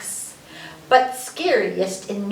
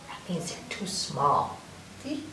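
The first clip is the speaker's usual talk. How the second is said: whispered aside; 7 semitones lower, 11 dB quieter than usual. Real, not acted.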